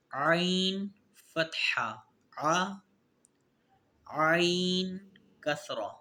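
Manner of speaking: slow qaida recitation drill. Shown as a demonstration. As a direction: spell each sound out for pupils. Drilling letter sounds aloud.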